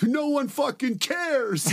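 high, slow drawn-out shout; imitated street heckler, stretched and high; heckling yell. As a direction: yell back at an annoying shouter. Homeless man yells back.

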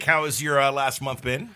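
ordinary talk, nothing marked

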